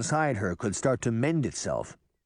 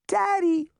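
put-on very high toddler's call; high, excited little-child shout; child crying out; 5 dB louder than usual. A narrator's reading. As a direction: cry out for a parent, pitched way up. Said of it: "the child cried".

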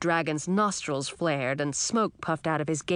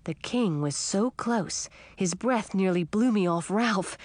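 plain reading voice

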